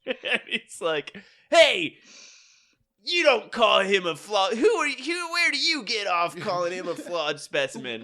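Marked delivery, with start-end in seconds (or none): 1.5-4.5 loud, high, hammed-up indignation; overacted defiance. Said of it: "he really overacts this one". 4.5-8.0 hammed-up indignant challenge; mock-outraged hero, tripping over the words; overacted defiance. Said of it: "he really overacts this one".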